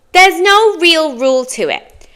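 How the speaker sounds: usual speaking voice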